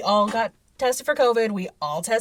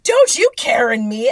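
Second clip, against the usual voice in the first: mock indignant; 9 dB louder than usual. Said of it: "And I was like".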